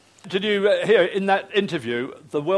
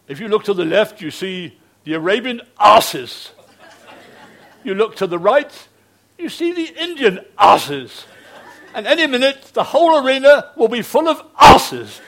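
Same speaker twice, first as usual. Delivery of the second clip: hamming up the commentator, laughing through it; imitating the commentator; 7 semitones higher, 10 dB louder than usual. Mimicking the Lahore commentator at the horse show, laughing and loud. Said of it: "he had this lovely Oxford accent".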